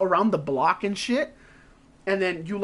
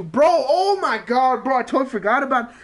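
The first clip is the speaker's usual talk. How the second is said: sudden excitement; 5 semitones higher, 5 dB louder than usual. Real, not acted. Suddenly remembers a game.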